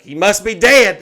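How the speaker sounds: put-on high, booming announcement in a kid's voice; loud child. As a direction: imitate a child loudly announcing bad news. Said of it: "like, she's so loud".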